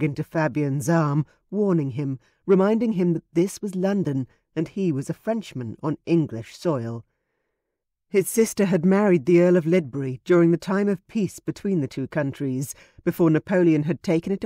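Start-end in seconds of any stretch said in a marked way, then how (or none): none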